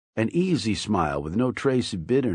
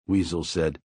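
ordinary delivery, nothing marked

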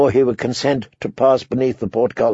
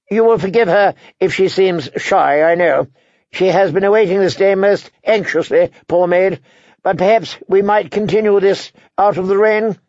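lightly voiced higher-pitched envoy; courteous envoy; 7 semitones higher than usual. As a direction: politely excuse the bride, pitched higher. Edwin Frey speaks, higher voice.

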